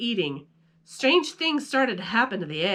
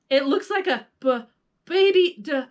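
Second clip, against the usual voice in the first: stammering, rising higher and louder, breaking off on each word; sputtering; 8 semitones higher, 6 dB louder than usual. A narrator's reading. PJ sputtering in shock at the baby dinosaur. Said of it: "P J sputtered".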